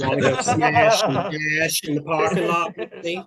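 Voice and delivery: camp it up and proposition him; campy come-on. Retold or imitated. Campy come-on to the big guy.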